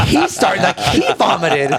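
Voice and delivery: laughing while telling; giggling through words. Real, not acted. Cracking up telling.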